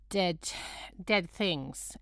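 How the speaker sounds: ordinary talk, nothing marked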